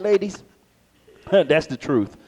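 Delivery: ordinary talk, nothing marked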